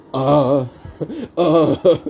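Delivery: awkward high laugh breaking through the uh; stuck for an answer, he laughs it off nervously. Real, not acted. Stunned by the release question.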